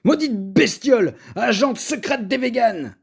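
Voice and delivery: loud, high furious curse; angry cursing. A narrator's reading. Paul cursing the pangolin, loud and angry. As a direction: spit rage at the beast.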